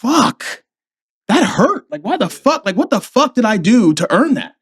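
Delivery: high-pitched, wounded retold protest; hurt. Marine passed over by his colonel, retold hurt. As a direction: ask it in acted hurt disbelief. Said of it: "That shit hurt me deeper".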